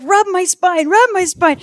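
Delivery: high-pitched, urgent mock shouting; shouted command. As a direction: reenact frantic orders from a labouring woman. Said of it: "shouting out commands to him".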